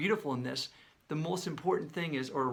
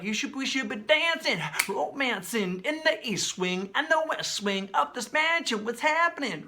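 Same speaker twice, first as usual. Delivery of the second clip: higher, louder playful crooning; imitating the singer; 8 semitones higher, 4 dB louder than usual. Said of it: "You know, like".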